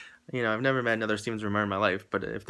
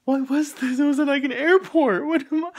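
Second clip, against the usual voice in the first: high incredulous exclaim; 6 dB louder than usual. Real, not acted. Outraged disbelief at the setup.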